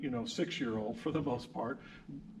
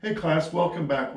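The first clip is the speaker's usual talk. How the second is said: upbeat, louder class greeting; loud welcome; 4 semitones higher, 7 dB louder than usual. Real, not acted. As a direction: call it out to the whole class. Host opens a class segment.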